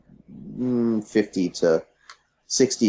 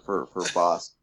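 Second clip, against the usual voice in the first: ordinary talk, nothing marked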